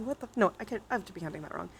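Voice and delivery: usual speaking voice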